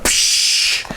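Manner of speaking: slow hissing imitation of hot iron on skin; imitated branding hiss; sizzle noise. Iron pressed on chest.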